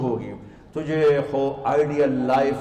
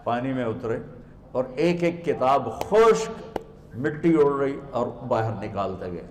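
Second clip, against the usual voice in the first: normal talking voice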